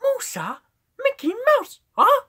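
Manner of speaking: very high falsetto squeak; Mickey Mouse. Shown as a demonstration. Streamer doing impressions, high Mickey Mouse voice. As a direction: jump into a squeaky cartoon falsetto. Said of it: "And somewhat of a Mickey".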